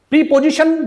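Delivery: "प्रीपोजिशन" as "pree-po-zishun"; incorrect pronunciation. Shown as a demonstration. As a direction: say "प्रीपोजिशन" with long EE and an unreduced O. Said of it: "incorrect pronunciation".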